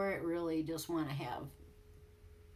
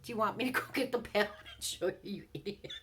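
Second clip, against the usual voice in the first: fast, louder scolding question; rapid mock retort; 3 semitones higher, 4 dB louder, faster than usual. Wife firing back at husband, fast and sarcastic. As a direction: rattle it off at him.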